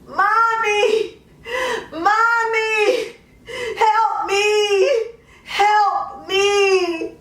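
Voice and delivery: high crying plea, voice breaking; mother voicing her daughter's cries for help; retold child's screams. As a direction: cry out like the terrified little girl. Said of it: "and screaming".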